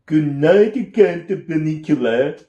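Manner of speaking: a mock Transylvanian count voice; sarcastic Transylvanian accent. A narrator's reading. Peter mocks the rabbit in a Transylvanian accent. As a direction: sneer it in a hammed Transylvanian accent. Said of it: "attempt at a Transylvanian accent".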